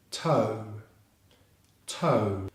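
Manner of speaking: "toe" with a strong T and gliding O; T plus O diphthong. Shown as a demonstration. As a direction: stretch "toe" alone as in "no". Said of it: "followed by the O vowel diphthong sound, as in no".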